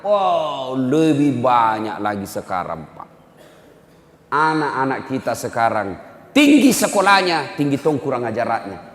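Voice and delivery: ordinary delivery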